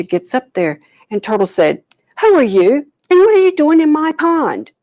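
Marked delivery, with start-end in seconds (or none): 2.2-4.7 high, loud demand; indignant shout. Acted. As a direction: shout at the stranger in your pond. Turtle confronts intruder.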